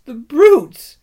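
high, loud weeping cry, falling; Lucy crying out at the brutes; tearful outrage. A narrator's reading. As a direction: repeat it, breaking with grief. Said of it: "tears streaming down her cheeks".

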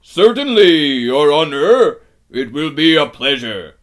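very loud, booming giant voice; obliging giant. A narrator's reading. Giant agrees to Aslan's request, booming and obliging. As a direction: boom it out eager to please. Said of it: "said the giant Rumblebuffin".

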